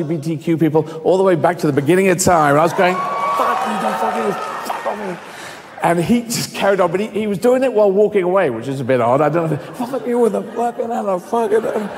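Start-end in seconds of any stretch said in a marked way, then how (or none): 2.9-5.2 high, mock-furious swearing; hammed-up indignant tirade; righteous anger. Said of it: "And I got righteous anger". 9.6-11.9 high, garbled abuse; comic gibberish swearing, pitched up; mock sweary tirade. Mimics man swearing while walking away.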